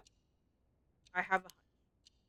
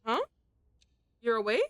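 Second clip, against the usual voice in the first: normal talking voice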